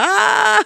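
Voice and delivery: acted high gasping scream; retold joyful shriek; high squeal of delight. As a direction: squeal at a longed-for treat. Acts out delight at seeing bread.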